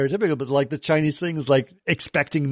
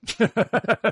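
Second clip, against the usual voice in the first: amused laugh; 7 semitones higher than usual. Real, not acted. Laughing at the mother's line.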